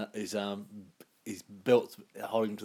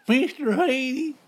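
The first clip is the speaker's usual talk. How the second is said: high, slow, stretched character voice; Mister Haney voice; 14 semitones higher, 8 dB louder, slower than usual. Retold or imitated. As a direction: drag out the name in a squeaky voice. Repeating the impression.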